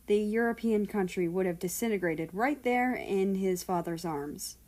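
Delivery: ordinary delivery, nothing marked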